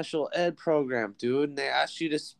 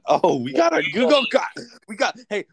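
excited; 9 semitones higher, 5 dB louder than usual. Real, not acted. Excited at helper arriving.

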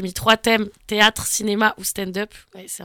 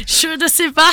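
hammed-up German accent, pitched up; put-on German accent; 6 semitones higher than usual. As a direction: play confused in a German accent. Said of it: "mais avec un accent allemand".

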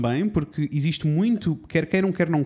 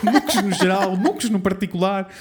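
giggling, louder; laughing; 4 semitones higher, 3 dB louder than usual. Real, not acted. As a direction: laugh through the list. Laughing over word list.

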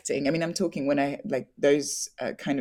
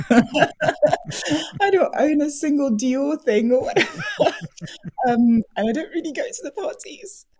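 laughing through the words; giggling while answering; 10 semitones higher, 6 dB louder than usual. Real, not acted. Laughing about showbiz glamour.

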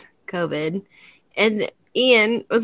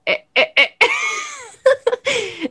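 laughing; much slower than usual. Real, not acted. Laughing stammer.

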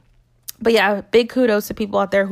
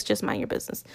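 whispered aside; 10 dB quieter, faster than usual. Real, not acted. Playful aside to the viewers.

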